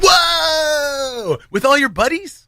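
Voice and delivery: high-pitched, thrilled astonishment; excited surprise. Real, not acted. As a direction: react big and high, delighted by the news. Reacting to co-host having watched it.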